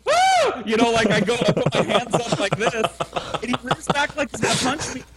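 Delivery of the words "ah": high loud startled yelp; mock startled yelp. Teller recalls flinching from a punch, high loud yelp. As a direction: yelp like you're about to get hit. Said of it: "and I'm like".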